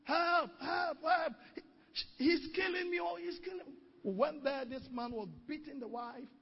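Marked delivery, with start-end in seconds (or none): 0.0-3.8 high, louder cries of help help; mock screaming for help. Pastor retells wife screaming help, high panicked cries. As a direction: cry out like a beaten wife. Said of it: "we heard the lady screaming".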